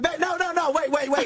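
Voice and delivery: high, urgent babe no no wait; frantic pleading. Retold or imitated. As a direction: stop her from walking in, urgent. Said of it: "I'm like".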